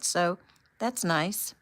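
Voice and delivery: ordinary delivery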